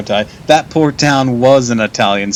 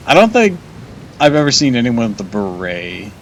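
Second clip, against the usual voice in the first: ordinary talk, nothing marked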